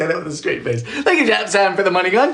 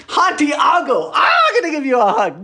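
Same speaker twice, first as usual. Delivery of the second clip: excited greeting; 9 semitones higher than usual. Real, not acted. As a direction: call out to a friend you want to hug. Excited greeting to arriving viewer.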